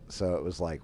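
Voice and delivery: usual speaking voice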